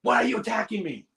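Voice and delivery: high-pitched, over-the-top yell; retold yelling. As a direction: snap into a loud, defensive outburst. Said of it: "I yelled at her".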